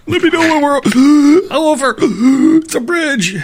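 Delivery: high, loud panic between big gasps; panicked gasping. Retold or imitated. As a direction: gasp hard and squeal the words out. Acting panicked rider crossing a bridge.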